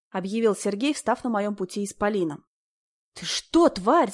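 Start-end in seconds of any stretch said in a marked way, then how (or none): 3.1-4.1 high furious shriek. A narrator's reading. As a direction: scream it out in rage. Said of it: "завизжала я".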